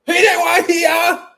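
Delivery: high, loud put-on shout; shrill high yelling woman voice; mock woman yelling. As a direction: yell at the cook.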